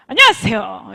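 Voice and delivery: loud, firm hello at normal pitch; confident greeting. Shown as a demonstration. Coach showing the right way to greet, loud and confident. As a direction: project the greeting boldly and firmly. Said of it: "이렇게 해주시면 됩니다".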